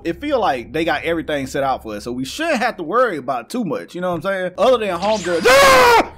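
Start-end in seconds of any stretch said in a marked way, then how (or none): 5.5-6.1 sudden high, loud yell; startled scream. Real, not acted. Gamer as homegirl appears from nowhere, startled scream. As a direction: scream at a jump scare.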